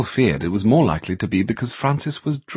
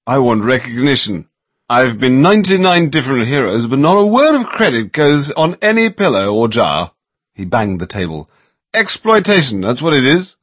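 loud slurred voice; 5 semitones higher, 5 dB louder than usual. A narrator's reading. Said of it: "in a loud, slurry voice".

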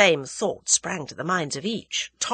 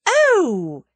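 high, loud "Oh!"; excited cry; 12 semitones higher, 7 dB louder than usual. A narrator's reading. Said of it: "cried Tuppence".

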